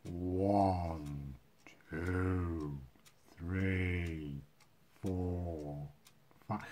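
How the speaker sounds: very slow, drawn-out numbers, low and soft. Shown as a demonstration. Magician repeats his sponge-ball count, slowly. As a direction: stretch each number right out, softly. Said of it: "a little bit slower".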